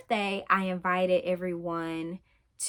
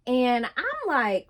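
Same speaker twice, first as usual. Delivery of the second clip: normal talking voice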